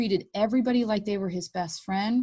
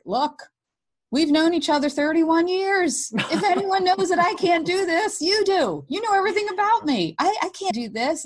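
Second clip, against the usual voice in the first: put-on high, louder pleading to an old friend; desperate plea; 10 semitones higher, 4 dB louder than usual. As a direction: beg with everything you have. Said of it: "I was doing this whole thing like".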